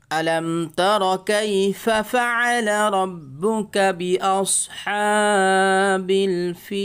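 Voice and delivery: slow, drawn-out Quranic chanting of فعل and الفيل; melodic recitation. Shown as a demonstration. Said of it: "reading কেমন হয় দেখেন".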